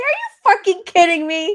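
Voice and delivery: high, loud, indignant disbelief; exasperated and incredulous; incredulous outrage. Retold or imitated. Outraged reply to retold refusal.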